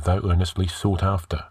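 ordinary delivery

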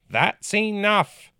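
high, slow and firm; stern order. A narrator's reading. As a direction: shut it down with command. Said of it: "ordered the counselor".

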